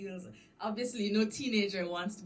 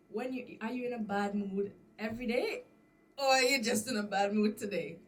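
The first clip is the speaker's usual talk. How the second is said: pointed question; 3 semitones higher, 8 dB louder than usual. Retold or imitated. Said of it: "I said".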